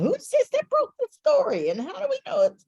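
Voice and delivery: high, nosy question; mocking curious crowd. Reporters at the press conference asking, high and puzzled. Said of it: "working for that long".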